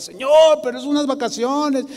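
high-pitched pleading protest, acted out; objecting. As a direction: protest to God like someone begging for an exception. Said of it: "yo empecé a objetar". (objeción suplicante en voz aguda, imitada)

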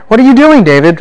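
high, loud burst of disbelief; exasperated mock scolding. Teacher addressing David who misses God's help, exasperated. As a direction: scold him in hammed-up dismay, pitch leaping up.

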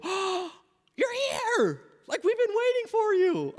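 put-on children's teacher gushing over an arriving kid. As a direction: greet a child like the star guest. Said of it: "there'll be teachers who go".

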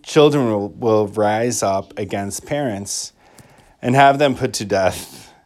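solemn liturgical reading; gospel proclamation. Acted. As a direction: proclaim it from the ambo.

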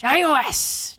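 loud, high pirate cry. Pretend. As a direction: bellow it like a pirate. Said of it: "fun thing to say in a pirate voice".

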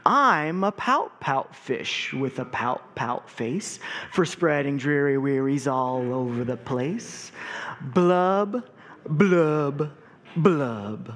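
slow, mopey sing-song fish refrain; glum pout fish. Acted. Gloomy fish chanting his refrain to his friends, slow and mopey. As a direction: drag out the gloomy fish's chant. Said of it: "there's a rhythm to this".

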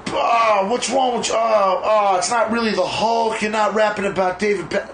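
high, mocking, scolding voice; mocking critics. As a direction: whine it like a clueless critic. Mocking critics who don't get it.

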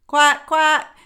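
loud, high duck call; loud quack. Acted. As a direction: call the ducklings like a mother duck. Mother calls her brood.

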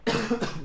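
sharp real coughing fit; coughing on water gone down wrong; swallowed wrong way. Real, not acted. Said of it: "water coughs 'cause I swallowed it the wrong way".

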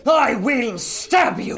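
slow, loud, high threat; furious shout. Acted. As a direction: scream the threat. Fight with mummy.

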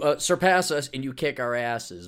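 normal talking voice

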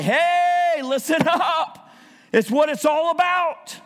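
high, drawn-out "hey" of delight; thrilled preacher calling out; excited exclamation. Acted. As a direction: cry it out high, stretching the words. Acts out Jesus's excited reaction.

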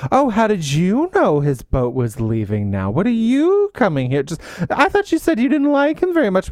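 hammed-up haughty priest, climbing high; mock pompous. Priest trying to catch Maurice out, high mock-pompous questioning. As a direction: put on pompous suspicion, swooping upward. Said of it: "so very pompous".

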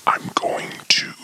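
hushed conspiratorial aside; whispered aside. Pretend. Host whispers to viewers while his partner fetches meat. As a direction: whisper it behind his back.

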